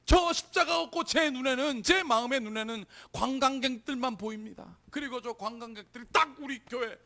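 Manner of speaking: loud, high outcry easing, then surging back; re-enacted lamenting prayer. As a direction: plead with God in a retold anguished prayer. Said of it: "막 탄식하면서 막 그냥 소리 지르면서".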